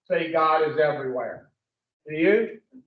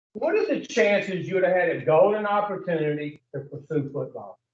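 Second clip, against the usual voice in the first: usual speaking voice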